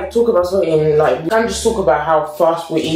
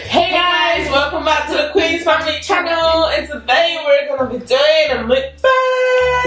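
excited intro; 8 semitones higher than usual. Shown as a demonstration. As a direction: shout the welcome with energy. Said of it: "Start all over again".

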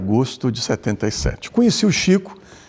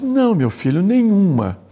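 lightly imitated gentle refusal, pitched higher; a high, drawn-up "no" to a hopeful asker; 8 semitones higher than usual. Medium answering a follower's question about [name], lightly imitated. Chico's disappointing answer, retold.

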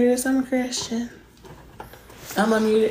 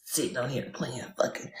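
whispered gripe; 8 semitones lower, 10 dB quieter than usual. Real, not acted. Griping under breath.

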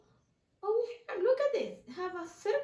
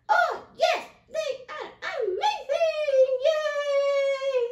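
much higher, louder mouse cheer; excited puppet mouse; 8 semitones higher, 7 dB louder, slower than usual. Acted. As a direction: squeal with delight as the mouse.

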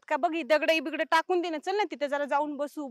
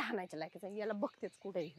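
स्वतःशी पुटपुट; 9 semitones lower, 16 dB quieter than usual. Acted.

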